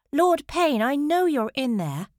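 high, loud demand at a door; insistent shout. A narrator's reading. Minerva pounds with renewed force, shouting insistently. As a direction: call out, refusing to be ignored.

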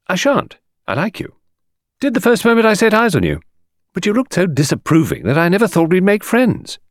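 rattling on with cheeky banter; cheeky girl. A narrator's reading.

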